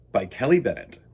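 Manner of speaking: usual speaking voice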